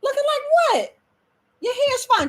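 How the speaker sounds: high playful tease; teasing. Real, not acted. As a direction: needle her affectionately. Teasing mom off camera.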